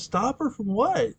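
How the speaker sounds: high-pitched baffled question; mock confused query; Quentin's bewilderment. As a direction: imitate someone clueless, pitch jumping up. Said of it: "he doesn't know what's going on".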